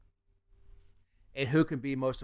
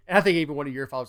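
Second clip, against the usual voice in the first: normal talking voice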